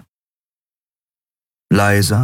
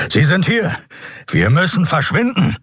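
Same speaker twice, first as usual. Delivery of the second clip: much higher, alarmed call to flee; alarmed urging; 11 semitones higher than usual. Acted. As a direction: sound the alarm. Keru alarms them, urgent. (Keru warnt die beiden, hoch und alarmiert)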